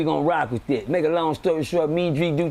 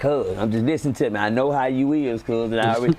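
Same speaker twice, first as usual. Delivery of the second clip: low voice of a prison veteran friend; Drico; 5 semitones lower than usual. Retold or imitated. Friend warning a newcomer about the unit, imitated low voice. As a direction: pitch it down, schooling the new guy. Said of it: "I gotta do my Drico voice".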